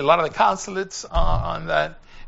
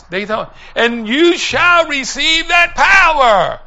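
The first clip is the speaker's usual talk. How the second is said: loud, high-pitched revival preaching; fervent preacher in full cry; full-force preaching; 6 semitones higher, 10 dB louder than usual. Real, not acted. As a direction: preach it out loud, building to a drawn-out peak.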